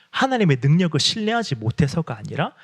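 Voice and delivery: usual speaking voice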